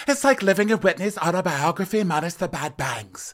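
high, lively Lisa voice; mock Lisa. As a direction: gossip like a delighted Lisa. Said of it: "And Lisa's like".

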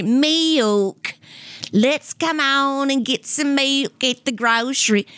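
mock a thick, uncouth Southern twang; rough Southern accent. Shown as a demonstration. Mother rounding up a grocery trip in thick Southern twang. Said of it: "But when you".

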